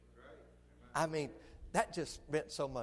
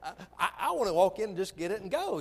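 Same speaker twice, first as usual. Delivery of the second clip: impatient; 7 semitones higher, 8 dB louder than usual. Retold or imitated. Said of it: "I was like".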